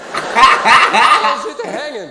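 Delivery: slow, laughing, drawn-out Aussie; Aussie greeting cracking up with laughter; laughing Aussie slang. Pretend. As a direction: stretch it out through laughter. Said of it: "Iraqis and Afghanis just all sitting there going".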